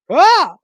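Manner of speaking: short, loud, high cry of shock; sudden high "ah!" of fright; startled yelp. Real, not acted. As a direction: yelp as if jumped at. Startled reaction to the show.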